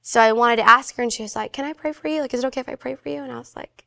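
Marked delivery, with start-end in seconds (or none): 1.5-3.2 soft, higher retold question; gentle offer. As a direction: ask softly, as a close friend. Friend gently asks to pray.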